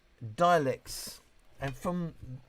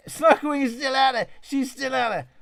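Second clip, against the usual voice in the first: much higher and louder, calling to a viewer; excited call-out; 12 semitones higher, 8 dB louder than usual. Real, not acted. Streamer calling out to a viewer in chat, excited. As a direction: hail a chat regular who's still around.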